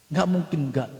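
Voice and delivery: ordinary delivery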